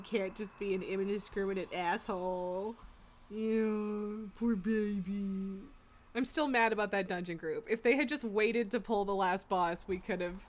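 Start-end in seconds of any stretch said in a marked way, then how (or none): 3.3-5.6 slow, drawn-out mock pity. Real, not acted. Owner cooing mock pity at her grumpy cat.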